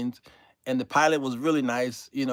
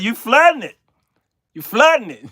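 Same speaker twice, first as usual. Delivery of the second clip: louder, higher call to the pilot; loud warning; 7 semitones higher, 10 dB louder than usual. Retold or imitated. Passenger calling to the pilot, loud and urgent.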